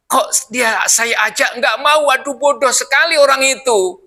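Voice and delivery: exasperated. Retold or imitated. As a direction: let the frustration burst out. Voicing the Prophet's exasperation.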